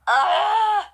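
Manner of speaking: sudden sharp hiccup, high and loud; a real hiccup bursting out. Real, not acted. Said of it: "I have the hiccups".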